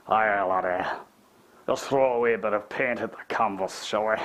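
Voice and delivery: a light put-on Scottish accent. Painter joking to his viewers in a mock Scottish accent. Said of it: "paint in a Scottish accent".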